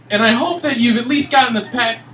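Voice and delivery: ordinary talk, nothing marked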